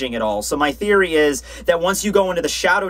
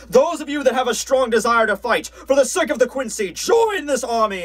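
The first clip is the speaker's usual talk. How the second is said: recruiting officer; 7 semitones higher than usual. Acted. Officer calling villagers to join the army, high mock proclamation. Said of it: "Hubert's up there, and he's just like".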